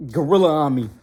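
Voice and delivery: ordinary delivery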